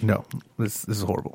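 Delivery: imitated teacher, hushed and low-key verdict; quiet disapproval. Teacher judging the student at every lesson, quiet mock disapproval. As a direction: drop the volume, mock grave disapproval.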